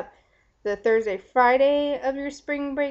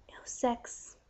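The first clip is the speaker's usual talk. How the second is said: hushed whisper of bad news; 8 dB quieter than usual. Real, not acted. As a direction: breathe it out as a secret. Whispered confession of abstinence.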